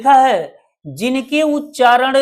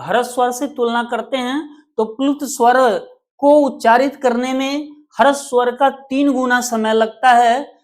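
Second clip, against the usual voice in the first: usual speaking voice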